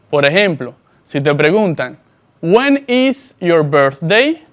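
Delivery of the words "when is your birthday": each word spaced out slowly for the learner; slow model sentence. Shown as a demonstration. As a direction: say it slowly and clearly, like a teacher modelling. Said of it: "presta mucha atención". (pregunta modelo, lenta y clara)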